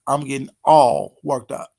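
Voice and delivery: usual speaking voice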